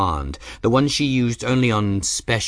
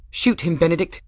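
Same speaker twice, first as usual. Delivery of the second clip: put-on harsh, higher girl's voice; grating young girl; 9 semitones higher, 3 dB louder than usual. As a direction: grate it out like a defiant girl. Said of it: "Bridget grated".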